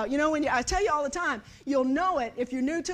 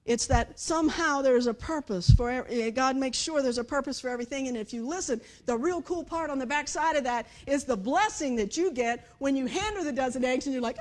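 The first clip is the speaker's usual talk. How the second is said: normal talking voice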